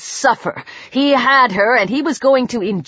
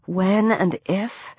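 softer, drawn-out questioning WHEN AND IF; sceptical echo; 3 semitones lower, 6 dB quieter, slower than usual. A narrator's reading. Heroine doubting her own escape plan, sceptical echo. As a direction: slowly query your own words, rising at the end.

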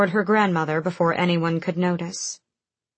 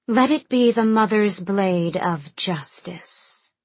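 slow solemn ceremonial voice; intoning; 3 semitones higher, slower than usual. Acted. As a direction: pronounce it like sacred law. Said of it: "Cressida intoned".

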